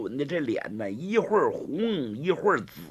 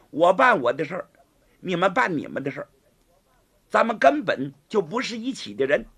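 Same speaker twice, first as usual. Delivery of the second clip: plain reading voice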